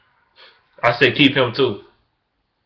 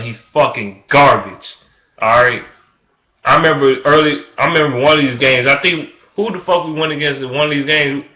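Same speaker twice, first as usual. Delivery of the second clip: normal talking voice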